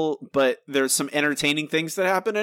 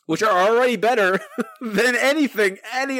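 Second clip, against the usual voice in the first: higher, laughing through the words; 9 semitones higher, 4 dB louder than usual. Real, not acted. Host ranking jokes above this episode, laughing. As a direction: break into a laugh mid-sentence.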